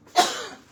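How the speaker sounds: sharp loud cough; real cough. Real, not acted. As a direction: cough hard. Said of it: "Excuse me".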